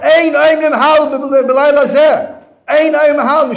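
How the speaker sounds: loud, high-pitched outraged shouting; imitated screaming protest; screaming rabbi. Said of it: "he comes screaming".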